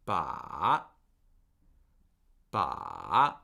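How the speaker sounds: "Ba" dipping very low, then rising; low fall-rise on Ba; third tone. Shown as a demonstration. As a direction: sink deep on "Ba" and swing back up. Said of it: "draw that in the air".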